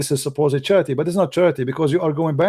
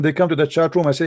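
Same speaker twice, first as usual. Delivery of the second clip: usual speaking voice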